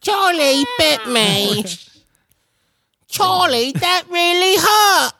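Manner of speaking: high, slow, drawn-out posh British child imitation; posh British accent. Quoting the viral bitten-finger video, high posh British accent. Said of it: "Switching to posh, uh, British accents".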